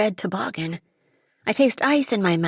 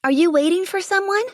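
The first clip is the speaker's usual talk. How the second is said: high, louder call across the snow; 11 semitones higher, 4 dB louder than usual. A narrator's reading. Girl calling out to the boy in the snow. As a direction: reach the boy over the wind. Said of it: "I call out".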